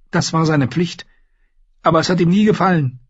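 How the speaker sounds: put-on earnest young lady protesting quickly; defensive Sansa. Sansa defending her father to the Hound, high and fast.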